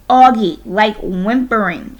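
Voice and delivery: put-on loud, exasperated older sister; impatient. A narrator's reading. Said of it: "she said impatiently".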